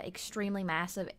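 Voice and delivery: ordinary talk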